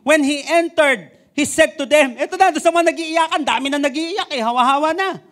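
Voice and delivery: high, loud, driving sermon voice; loud excited preaching. Acted. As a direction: build the scene with fire. Excited preaching about the mourners. (masiglang malakas na pangangaral)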